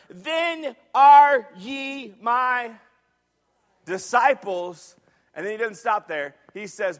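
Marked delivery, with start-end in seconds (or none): 0.1-5.0 very slow, loud, word by word; emphatic scripture reading. Real, not acted. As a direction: stretch each word out for the congregation.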